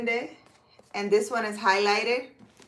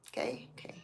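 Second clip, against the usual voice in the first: whispered okay; 18 dB quieter, much faster than usual. Real, not acted. Whispered aside.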